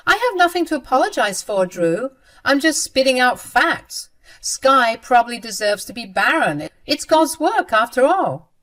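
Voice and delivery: lightly voiced mean sister, spitting out her "facts"; smug sister.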